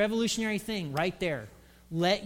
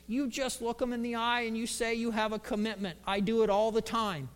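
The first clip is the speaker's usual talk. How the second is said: raspy mentor voice; Lyle; 2 semitones higher than usual. Acted. Mentor answering Brother Max's worry, raspy. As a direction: rasp out confident advice. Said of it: "had this kind of raspy voice".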